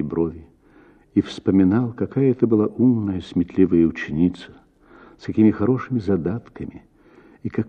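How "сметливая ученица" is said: usual speaking voice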